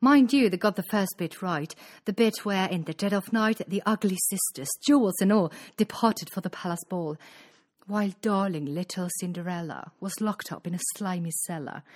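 ordinary delivery

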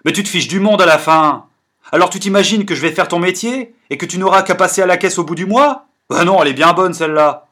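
crié, plus aigu et rapide; colère. Acted. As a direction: explose d'indignation en coupant la parole. Forestier coupe la parole, furieux. (Forestier qui engueule son ami, moqueur et furieux)

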